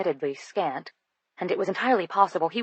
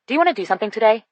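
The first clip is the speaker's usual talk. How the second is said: blurted out fast, higher and louder; 5 semitones higher, 6 dB louder, much faster than usual. A narrator's reading. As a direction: rush it out in one breath. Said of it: "I blurted".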